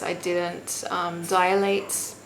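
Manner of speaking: ordinary delivery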